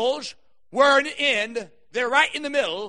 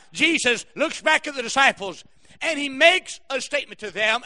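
ordinary talk

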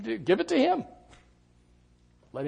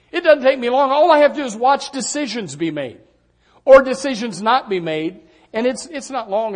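rising preaching; 7 semitones higher, 6 dB louder than usual. Real, not acted. Preaching builds high and loud.

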